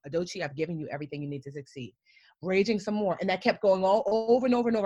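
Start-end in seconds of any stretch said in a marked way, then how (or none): 0.1-1.9 much softer, steady and low; soft voice of God answering her; calm inner voice. Retold or imitated. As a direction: speak as a quiet inner voice of reassurance. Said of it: "So I'm raging some more".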